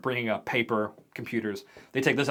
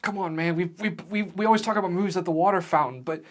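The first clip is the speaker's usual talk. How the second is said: high-pitched, stammering refusal; anxious pleading; 9 semitones higher than usual. Acted. Peter to Chet, refusing to open the door, anxious pleading. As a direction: plead nervously with a friend.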